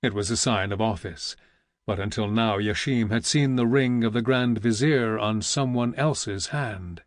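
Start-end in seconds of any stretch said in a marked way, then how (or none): none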